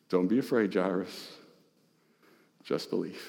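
soft, low and gentle comfort; tender reassurance. Acted. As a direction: reassure a frightened father quietly. Jesus comforts Jairus.

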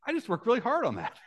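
high, laughing protest; laughing mock complaint. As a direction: whine through a laugh. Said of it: "I'd be like".

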